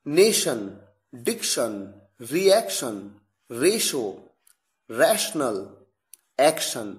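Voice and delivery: नेशन and एक्शन with a sh sound; tio sh examples. Shown as a demonstration. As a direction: model each tion word with sh. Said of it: "शलगम वाले श की ही ध्वनि आती".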